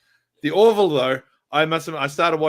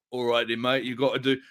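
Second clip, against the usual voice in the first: bad English accent, a touch lower; 4 semitones lower than usual. Actor retaking the line on set, in a bad English accent. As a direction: back into a low, hammy English accent. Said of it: "I'm gonna put on a really bad English accent".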